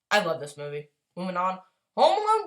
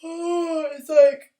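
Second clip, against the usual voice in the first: groan; 9 semitones higher, 8 dB louder than usual. Real, not acted.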